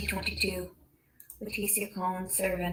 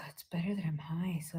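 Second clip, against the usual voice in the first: softer whispered confession; 3 semitones lower, 8 dB quieter than usual. Real, not acted. Stoned late at night, telling the camera in a whisper. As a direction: murmur it to yourself.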